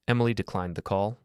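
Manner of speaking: ordinary delivery, nothing marked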